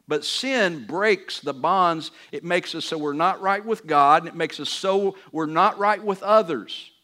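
strong pulpit proclamation; preaching at full force. Acted. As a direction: hammer the message home.

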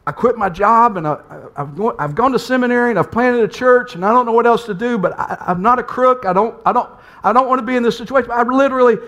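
imitating a desperate outpouring; pouring heart out. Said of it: "I just start pouring my heart out".

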